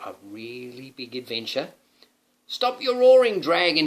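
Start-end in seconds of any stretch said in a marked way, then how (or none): 2.5-3.9 loud, high knightly command. Acted. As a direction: order the dragon to stop. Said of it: "he commanded".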